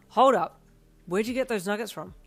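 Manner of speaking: loud, sharp demand. A narrator's reading. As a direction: snap it out suddenly. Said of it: "he asked sharply".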